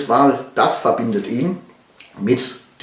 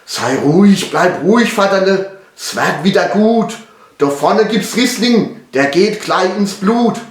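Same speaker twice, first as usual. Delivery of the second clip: lightly acted son, higher pitched, soothing his father; calm him down in Pfälzisch dialect; reassuring son; 8 semitones higher, 4 dB louder than usual. (beschwichtigender Sohn, leicht gespielt)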